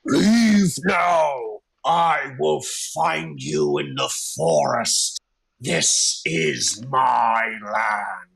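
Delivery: huge voice booming out of a cave; booming dragon voice. Acted. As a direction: boom it out like a dragon. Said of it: "the same dragon voice booms out again".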